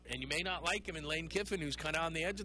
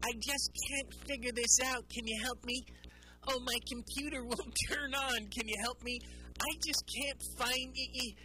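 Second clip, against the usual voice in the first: higher, put-on helpless older parent asking for tech help; imitating my parents; 7 semitones higher than usual. Host mocking his parents' tech pleas, higher helpless voice. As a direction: plead like a lost parent holding out an iPhone. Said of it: "you sound like, you know, my parents".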